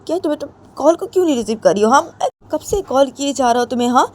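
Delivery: high, loud, fast pressing questions; a hurt friend pressing on the phone; urgent pleading. A narrator's reading. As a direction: demand why she won't pick up. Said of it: "जिया वो बोलती".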